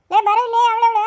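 put-on aunt calling the girl over loudly. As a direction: order the child over.

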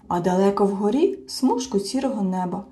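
ordinary delivery

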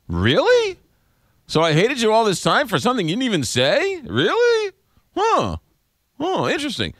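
high, mock astonished woman's voice; surprised woman. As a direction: play her, stunned and high. Host voices surprised woman listener.

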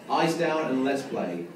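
slow, drawn-out bingo number calls; bingo caller. Acted. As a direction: call each number out, pausing between. Calling bingo numbers to hall.

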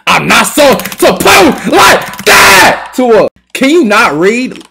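slow, loud and high shouted warning; slow, furious yell; angry shout. Real, not acted. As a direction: bellow it at him. Gamer yelling at other player.

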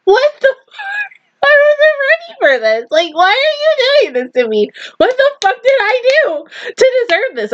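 high, wailing protest; aggrieved disbelief. Real, not acted. Viewer asking the show why it does this, high aggrieved wail. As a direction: plead in a high, whining outcry.

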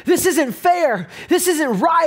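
retold bitter grumble; bitter complaint. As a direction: replay his own sulking outrage. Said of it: "I was frustrated".

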